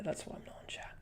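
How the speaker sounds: whispered realisation, breath only. Real, not acted. Streamer realising his laptop has no Wi-Fi, whispered. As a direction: murmur it to yourself, voice off.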